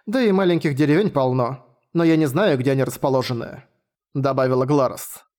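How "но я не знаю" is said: ordinary delivery, nothing marked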